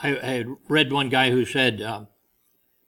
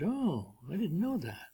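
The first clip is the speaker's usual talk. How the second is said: a hint of wide-eyed Eve, higher and softer; naive Eve; 4 semitones higher, 11 dB quieter than usual. Eve answering the serpent, lightly voiced naive surprise. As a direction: play the gullible woman lightly, hushed and higher. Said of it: "She said".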